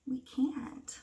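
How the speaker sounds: hushed whispered answer; a secret whispered to the class. Real, not acted. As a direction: lean in and whisper it. Hushed answer to children's question.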